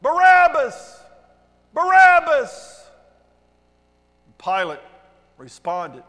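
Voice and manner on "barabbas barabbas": loud, high chanting yell; full-voiced crowd cry, stretched out; crowd shout. A narrator's reading. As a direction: shout it out like an angry mob. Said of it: "the crowd shouted back".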